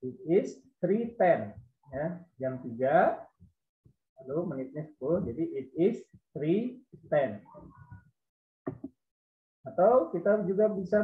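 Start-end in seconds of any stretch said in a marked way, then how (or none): 0.0-1.6 slow and clearly spaced; slow careful model. Shown as a demonstration. Teacher models the time for pupils, slow and clear. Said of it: "Cara pengucapannya". 5.6-7.4 slow and clearly spaced; slow careful model. Shown as a demonstration. Teacher models the time for pupils, slow and clear. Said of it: "Cara pengucapannya".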